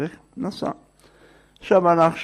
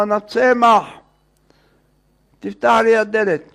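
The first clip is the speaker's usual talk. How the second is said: higher, raised voice at a door; calling out; 6 semitones higher, 4 dB louder than usual. Retold or imitated. Visitor names himself through the door, calling out. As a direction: call out your name to be let in. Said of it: "אז צועק לו".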